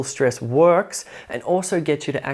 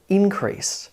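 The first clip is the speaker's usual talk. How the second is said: increase as a noun, IN high and strong; INcrease, high start falling away; stress on first syllable; 4 semitones higher than usual. Shown as a demonstration. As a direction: hit the IN of increase. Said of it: "you emphasize that first syllable".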